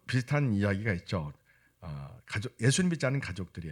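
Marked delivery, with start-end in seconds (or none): none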